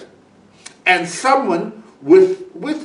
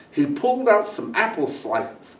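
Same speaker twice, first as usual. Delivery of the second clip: ordinary delivery, nothing marked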